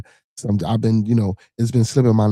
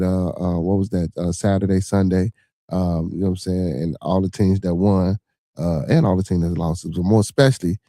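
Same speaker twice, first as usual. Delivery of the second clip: normal talking voice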